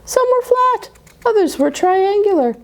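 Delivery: high, sing-song delight; playful high reveal. Acted. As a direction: squeal the shapes out for fun.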